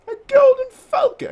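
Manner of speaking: shrieked high and loud announcement; excited shout. Acted. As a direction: shout it out like a herald spotting the bird. Announcing the falcon.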